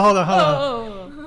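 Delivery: laughing hold on, slowed. Real, not acted. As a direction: laugh through the words. Laughing while calming others.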